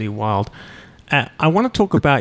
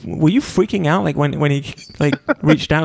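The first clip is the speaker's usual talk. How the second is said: ordinary delivery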